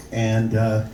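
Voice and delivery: ordinary talk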